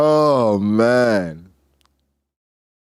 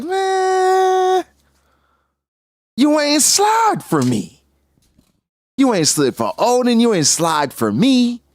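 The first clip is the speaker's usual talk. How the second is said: much higher, slow complaining voice; whiny Thatch; 13 semitones higher, slower than usual. Retold or imitated. Thatch scolding Whitebeard, high slow whine. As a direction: pitch way up and whine it slowly. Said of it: "He greeted Blackbeard like".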